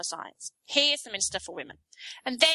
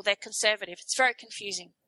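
normal talking voice

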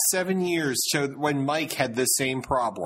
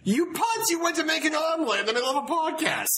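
much higher, teasing yell at a late cohost; outraged shout; 11 semitones higher than usual. Real, not acted. Host scolding cohost who made an omelet, high teasing yell. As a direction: squawk it in mock outrage.

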